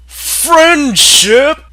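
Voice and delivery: hammed-up shocked question; incredulous shout. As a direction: repeat it in disbelief. Mock incredulous repeat.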